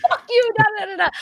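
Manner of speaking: high mock exasperated retort; imitating Matt. Matt snapping back mid-argument, high mock voice. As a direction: throw it back at her, squeaky and dismissive. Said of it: "and he's".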